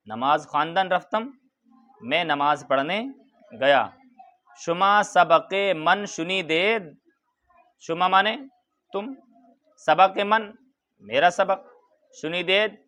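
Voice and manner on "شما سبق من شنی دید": slow recitation. Shown as a demonstration. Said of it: "teacher reads Persian line slowly".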